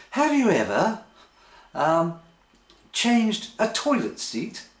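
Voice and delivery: high, slowing mock question; exaggerated high questioning voice; put-on partner's voice. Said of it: "I just like the voice".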